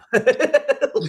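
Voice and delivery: high loud laugh; high burst of laughter. Real, not acted. Laughing after retold punch.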